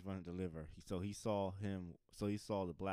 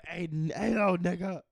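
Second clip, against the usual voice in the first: loud, higher call-out; mock hailing; 9 semitones higher, 9 dB louder than usual. Comedian acts out neighbor hailing the delivery driver, calling out. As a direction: holler for attention in a put-on confrontation.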